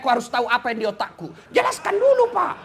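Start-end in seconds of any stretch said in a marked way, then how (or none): 1.5-2.6 demanding. Retold or imitated. Mock demand from an official.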